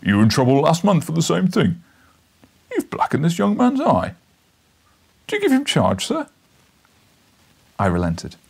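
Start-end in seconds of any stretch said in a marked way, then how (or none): 0.0-6.3 loud gruff constable; stern policeman. Acted. Policeman breaking up the fight, loud and stern. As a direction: scold them like a bobby. Policeman rebukes, asks reporter.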